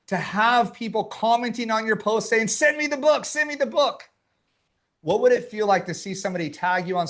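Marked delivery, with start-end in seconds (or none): 2.5-4.1 high-pitched imitation of clamoring fans; eager commenters. Voicing eager commenters on a post.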